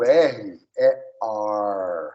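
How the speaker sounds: last "R" as a long curled American "ar". Shown as a demonstration. Teacher reminds student, "R" ending in American "ar". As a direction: slowly contrast "R" in Portuguese with a held English "R". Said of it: "contanto que você lembre".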